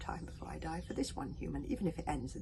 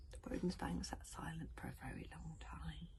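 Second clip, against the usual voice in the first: usual speaking voice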